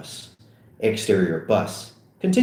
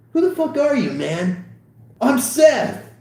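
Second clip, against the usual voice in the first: higher, louder rowdy voice; rowdy party guy; 4 dB louder than usual. Acted. Random guy and Seth trading shouts, higher and louder. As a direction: shout over the party noise.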